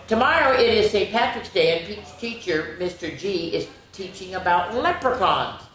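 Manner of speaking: usual speaking voice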